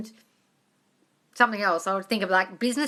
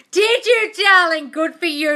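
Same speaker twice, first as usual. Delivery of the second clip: much higher and louder, gushing; delighted; 9 semitones higher, 10 dB louder, slower than usual. Real, not acted. Host reacting to a viewer's podcast vision, delighted. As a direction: gush with surprise at the news.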